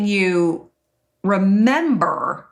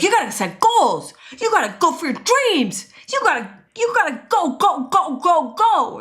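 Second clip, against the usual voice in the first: hyped self-help guru; 10 semitones higher than usual. Retold or imitated. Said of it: "they're like".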